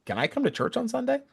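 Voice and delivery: imitating her softened request; humbled question. Lady asks the missionaries, humbly won over. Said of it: "looked at us and said".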